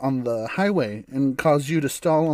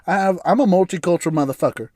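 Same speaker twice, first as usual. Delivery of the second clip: usual speaking voice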